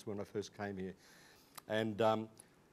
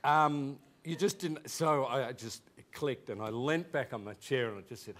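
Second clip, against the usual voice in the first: ordinary talk, nothing marked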